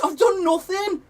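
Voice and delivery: high, slow, aggrieved protest; protesting innocence. Retold or imitated. Player protesting to his manager he did nothing. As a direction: plead innocence, drawn out.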